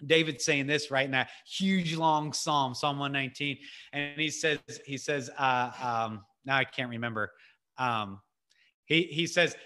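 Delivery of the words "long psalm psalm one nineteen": ordinary talk, nothing marked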